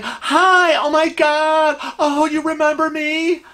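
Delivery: high-pitched gushy greeting, drawn out; gushing lady. Acted. Lady rushes up to a man she thinks she knows, gushing. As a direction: greet him like a long-lost friend, high and stretched. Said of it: "this lady comes up".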